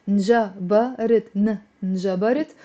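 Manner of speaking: Njabarit spoken very slowly, every sound clear; slow model word. Shown as a demonstration. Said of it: "You can say njabarit".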